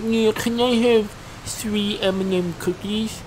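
slow, high put-on kid voice; mock fat kid. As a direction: imitate a kid ordering, dragging it out high. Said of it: "this fat kid in front of me".

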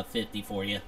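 usual speaking voice

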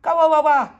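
put-on loud babbling toddler voice, repeated; mock toddler babble, sing-song and loud. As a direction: chant it like a small child demanding music. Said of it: "bang them together and say".

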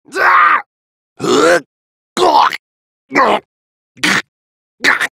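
short, loud combat grunts; fight cries. Acted. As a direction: cry out with each blow. Attack and hit cries.

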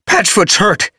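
high, loud panicked cry; gasping in fear. A narrator's reading. Apprentice gasping out the alarm to his leader, high and loud.

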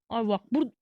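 usual speaking voice

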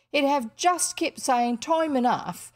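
blunt working-class housekeeper, lively rise and fall; emphatic working woman's voice with dropped letters; working-class Ellen. Acted. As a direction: scold and advise with unrefined vowels. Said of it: "she doesn't have refined vowels".

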